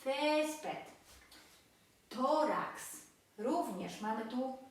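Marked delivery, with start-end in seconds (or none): 0.1-0.8 Césped with a high first syllable; penultimate stress. Shown as a demonstration. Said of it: "zaznaczamy nad E". 2.1-3.0 Tórax with a prominent first syllable; penultimate stress. Shown as a demonstration. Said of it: "akcent pada na przedostatnią sylabę".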